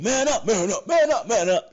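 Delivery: loud, high mock chant of a catchphrase; high-pitched imitation of a yelled slogan; imitating catchphrase shouts. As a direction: shout it repeatedly like a wrestler's catchphrase. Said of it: "they kept saying".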